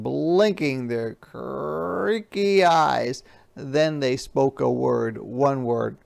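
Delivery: plain reading voice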